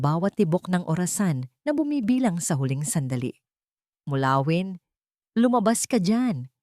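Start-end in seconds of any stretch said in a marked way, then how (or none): none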